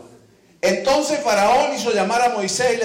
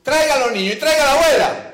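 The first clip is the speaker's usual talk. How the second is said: incredulous rebuke; 5 semitones higher than usual. Retold or imitated. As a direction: scold them for leaving the kids home. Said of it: "Y le digo".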